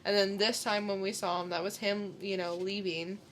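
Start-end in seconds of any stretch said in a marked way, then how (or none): none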